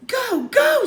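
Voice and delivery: high, loud urgent "go, go"; urgent shout. Acted. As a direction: hurry her up, yelling. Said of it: "said Jack".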